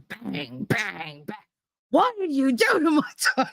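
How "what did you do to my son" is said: high, raging dad voice; father shaking the boy, raging; enraged father. Retold or imitated. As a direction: demand it like a furious father. Father confronting the neighbour kid.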